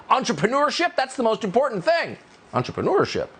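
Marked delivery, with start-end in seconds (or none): 0.1-2.2 high-pitched parody of insistent yelling; high, hammed-up slogan yell without much extra volume; mock yell. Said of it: "used to yell at you".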